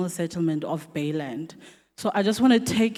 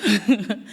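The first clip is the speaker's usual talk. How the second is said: short warm laugh; light laugh; 4 dB louder than usual. Real, not acted. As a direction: laugh it off brightly. Greets panelists with a laugh.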